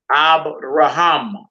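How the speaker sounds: "Abraham" slowly as ah-bra-HAM; last-syllable stress. Shown as a demonstration. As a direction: draw out the final "ham" of "Abraham". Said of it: "accent on last syllable".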